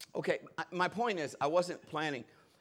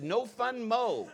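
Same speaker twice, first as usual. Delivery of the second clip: slow, drawn-out mock Korean accent; mimicked Korean accent; 2 semitones higher, 4 dB quieter, much slower than usual. Pastor blurting a reply to the Korean lady, mimicked accent. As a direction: echo her Korean accent, stretching each word. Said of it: "Right away out of my mouth".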